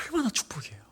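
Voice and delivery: unvoiced, hushed 얼마나 축복이에요; whispered repeat. Shown as a demonstration. Preacher to congregation, repeating the blessing as a whisper. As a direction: breathe 얼마나 축복이에요 as a secret.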